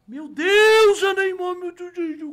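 high, loud hysterical yell; hammed-up ecstatic screaming; starstruck freak-out. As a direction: shout it like a crazed fan. Man going crazy at Neymar video.

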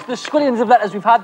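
usual speaking voice

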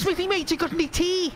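high Scouse voice asking for tea; Scouse impression. Friend imitates Spiff asking Smithy for tea in Scouse. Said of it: "why do you put on this weird voice".

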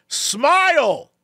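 high, called-out command; dad calling out. Retold or imitated. Dad urging son to smile, high call. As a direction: coax from across the counter. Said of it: "I hear him go".

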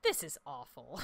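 ordinary delivery

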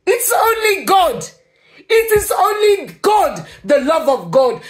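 impassioned cry. Real, not acted. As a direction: proclaim it with everything you have. Emotional testimony about God's love.

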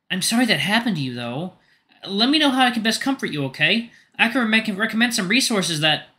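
kind, concerned friend; Rofi's voice. Acted. Narrator voices Rofi.

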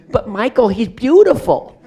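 high, loud, gushing imitation; casting woman insisting. Casting woman defending her pick to the director, gushing imitation. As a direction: plead his case, mimicking her at full volume.

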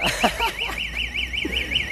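high burst of laughter. Real, not acted. Streamer amused by viewer insulting his wine, laughing. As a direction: laugh it out after the joke.

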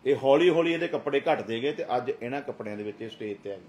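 loud, high preaching at full force; fiery preaching. Acted. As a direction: thunder it at the congregation. Pastor rebuking at full force.

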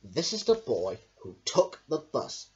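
ordinary delivery, nothing marked

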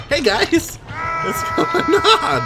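high, loud, stretched-out call; frantic greeting. Real, not acted. Player greeting teammates, high loud call. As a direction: shout hello with the last words drawn way out.

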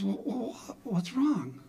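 high, stammering concern; high-pitched, flustered questioning; worried wife. Retold or imitated. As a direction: imitate a wife just shaken awake. Just-woken wife asks, retold.

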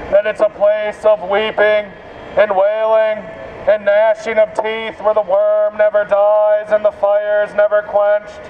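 ordinary delivery

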